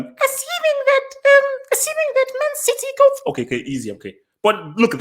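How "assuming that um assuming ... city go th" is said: very high falsetto woman impression; badly faked female voice, squeaky high; imitating female presenter. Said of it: "that's how she talks".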